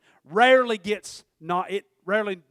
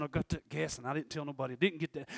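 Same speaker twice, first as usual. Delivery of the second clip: guilty inner voice; 7 semitones lower, 9 dB quieter, faster than usual. Retold or imitated. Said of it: "I'm sitting there going".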